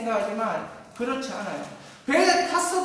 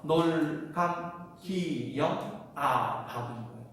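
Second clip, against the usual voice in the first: 놀 감 기 영 박 drawn out very slowly and low; slow spelled-out syllables; 7 semitones lower, 7 dB quieter, slower than usual. Shown as a demonstration. Pastor recapping the acrostic, slow and low. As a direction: stretch out each acrostic syllable, softer. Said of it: "repeats acrostic slowly".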